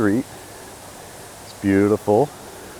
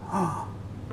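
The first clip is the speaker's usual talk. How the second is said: gasp; 10 semitones higher, 10 dB quieter than usual. Real, not acted. Discovering the room.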